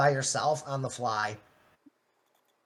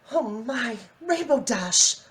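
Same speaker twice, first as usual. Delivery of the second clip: high, refined Rarity; 7 semitones higher, slower than usual. Acted. Rarity calling through the door to Cream, high and refined. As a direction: lift the voice up into a posh pony.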